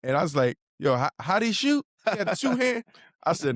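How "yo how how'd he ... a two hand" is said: incredulous question. Retold or imitated. Skeptical question about shooting form.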